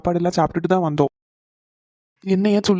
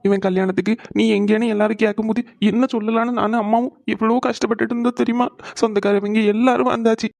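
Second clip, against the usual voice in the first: tearful, higher-pitched pleading; crying; 3 semitones higher than usual. Acted. Father telling his son how hard the wedding was without him, crying. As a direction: pour out the hurt through tears. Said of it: "அல்லாதீங்க".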